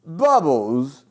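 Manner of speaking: high, loud, slow [name] voice; [name] impression. [name] rejecting Sharon's bubbles idea, loud [name] voice. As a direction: bellow it like [name]. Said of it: "He was like".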